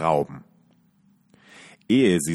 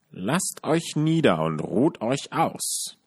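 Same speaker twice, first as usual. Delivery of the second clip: put-on higher, slow, sugary old witch; falsely friendly witch; 6 semitones higher, slower than usual. A narrator's reading. Witch invites the guests to rest, falsely sweet. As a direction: lure them in with fake kindness. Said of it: "tat ganz freundlich".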